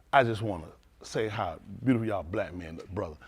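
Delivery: a low, soft lady's compliment; low and soft, admiring. Retold or imitated. Voicing the lady outside.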